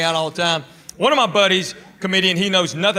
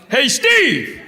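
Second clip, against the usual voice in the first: raised, higher-pitched holler; yell out; 7 semitones higher, 4 dB louder than usual. Acted. Comedian calling to his buddy in a movie theater, loud yell. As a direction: holler it across a packed room. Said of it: "and I just yell out".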